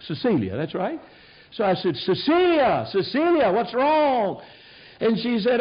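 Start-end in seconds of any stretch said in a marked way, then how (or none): 2.0-4.4 calling out. Retold or imitated. Calling out to distressed helper.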